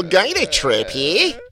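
put-on slow, hammy Australian accent; a badly faked Australian accent. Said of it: "how awful my Australian accent is".

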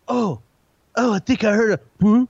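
hushed, spooked ghost hunter; mock ghost hunter. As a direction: play a jumpy ghost hunter. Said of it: "they're like".

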